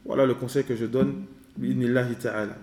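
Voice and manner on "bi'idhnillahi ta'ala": normal talking voice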